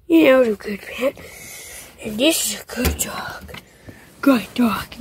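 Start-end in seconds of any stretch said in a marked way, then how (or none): 2.0-5.0 soft whisper to the dog. Real, not acted. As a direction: whisper praise to a pet. Petting the game dog.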